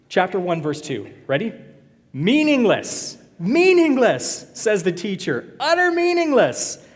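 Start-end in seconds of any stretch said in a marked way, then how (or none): 2.1-4.5 loud, high, long-drawn-out cry; dramatic proclamation. Acted. Dramatic reading of the verse. 5.6-6.8 soaring high, loud and drawn out; dramatic proclamation. Acted. Dramatic climax of the verse.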